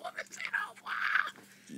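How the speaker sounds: whisper. Retold or imitated.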